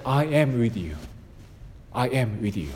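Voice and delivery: tender promise. Acted. Preacher voicing God's promise to listeners, hushed and tender. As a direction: hush it like a comforting promise.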